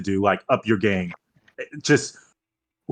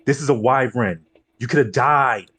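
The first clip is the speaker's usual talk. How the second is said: ordinary delivery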